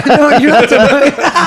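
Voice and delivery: high laughing protest; laughing so hard the words stretch; cracking up while taking it back. Real, not acted. Laughing retraction.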